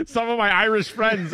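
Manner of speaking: a hint of Irish in a loud, laughing retelling; lightly voiced Irish stranger; mild Irish accent. A passerby explaining his Irish friends, lightly Irish. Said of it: "He's like".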